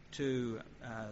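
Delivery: ordinary talk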